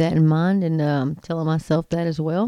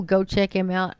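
usual speaking voice

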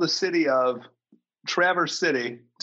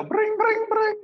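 phone ring imitation; slower than usual. Mimics phone ringing again.

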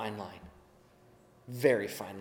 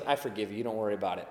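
lower, softer merciful king; gentle forgiving king; 8 semitones lower, 9 dB quieter, much faster than usual. Retold or imitated. King quietly forgiving the ruler's debt, softer and lower. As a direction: let the debt go kindly. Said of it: "the king goes".

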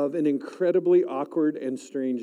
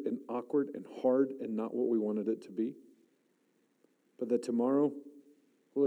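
hushed, softer prayer voice; quiet prayer; 5 semitones lower, 4 dB quieter than usual. Real, not acted. Kneeling preacher prays over students, soft and hushed. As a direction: pray softly over the students.

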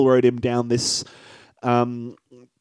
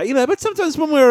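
hammed-up high voice; 11 semitones higher, 3 dB louder, faster than usual. Hammy intro of the segment.

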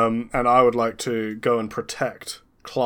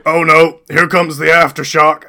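alarmed; 6 semitones higher, 12 dB louder than usual. Acted. Character alarmed at aftershock.

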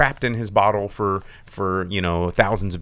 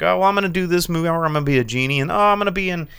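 mock casual actor; 5 semitones higher, 3 dB louder, faster than usual. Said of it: "And then he's like".

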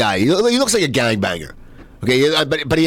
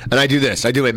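ordinary delivery

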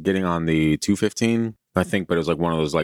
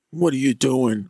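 angry father; 8 semitones higher than usual. Retold or imitated. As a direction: bark it like a furious father. Acted father confronting son.

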